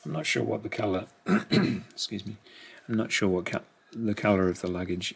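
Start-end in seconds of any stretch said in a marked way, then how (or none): none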